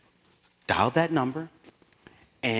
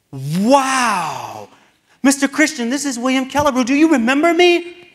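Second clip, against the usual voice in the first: much higher and louder, bursting with excitement; overjoyed to hear his old teacher's voice; excited greeting; 12 semitones higher, 9 dB louder than usual. Retold or imitated. Calling his old teacher, a high, loud, excited hello.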